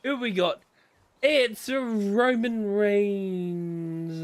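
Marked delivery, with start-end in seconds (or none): 1.2-4.2 very slow, loud ring announcer's drawl; drawn-out mock announcement. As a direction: stretch every word like a ring announcer.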